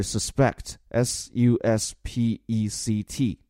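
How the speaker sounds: suspect then S U S P E C T letter by letter, slowly; spelled out. Shown as a demonstration. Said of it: "spells the word out".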